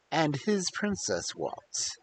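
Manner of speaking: usual speaking voice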